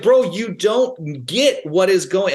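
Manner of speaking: imitating his own frantic protest; mock protest. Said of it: "I'm like".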